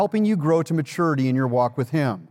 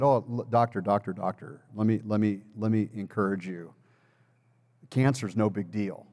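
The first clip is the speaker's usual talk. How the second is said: retold calm reassurance to the doctor, lower and softer; 7 semitones lower, 6 dB quieter than usual. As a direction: lower and softer, gently setting the doctor straight. Calmly reassuring his doctor.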